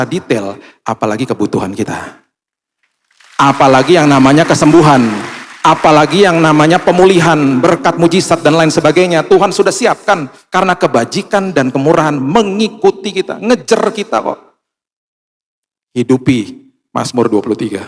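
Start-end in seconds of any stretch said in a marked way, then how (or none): 3.4-14.6 rising, louder pulpit build; preacher driving home the point to the congregation, building and loud; preaching. Acted. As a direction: preach it to a climax. Preaching climax.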